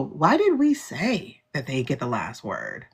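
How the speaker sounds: musing on a bad decision, slowly; slow regretful question. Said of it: "They're like".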